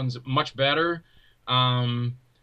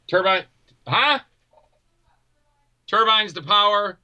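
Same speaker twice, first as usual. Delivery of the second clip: 1 mock Batman command; 6 semitones higher, 4 dB louder than usual. Partner quoting Batman TV show.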